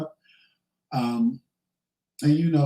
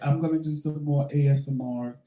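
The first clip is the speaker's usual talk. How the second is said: usual speaking voice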